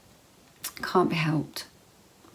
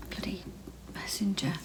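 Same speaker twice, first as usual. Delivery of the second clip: quiet muttered curse under the breath; 2 semitones higher, 8 dB quieter, faster than usual. Real, not acted. Vlogger scolding herself after a mistake, muttered softly. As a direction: grumble it at yourself, softly.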